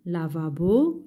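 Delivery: ordinary talk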